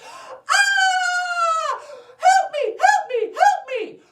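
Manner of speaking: very high, loud screams for help; panicked screaming. Acted. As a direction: scream like a terrified little girl. Girl screams on seeing wolf.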